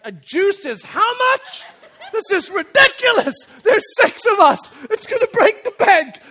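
loud, high-pitched indignant exclaiming; hammed-up outrage. Dad reading the cafe menu, comically outraged. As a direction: balk at the price with mock horror.

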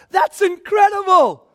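a real burst of awe; amazed outburst. Real, not acted. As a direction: shout your wonder at it. Preacher marvels at Jesus' call.

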